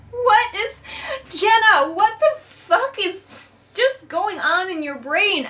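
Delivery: high, loud, slowed outburst of disbelief; exasperated disbelief. Real, not acted. Reviewer asking the author Jenna, exasperated and high. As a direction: pitch way up, baffled and fed up.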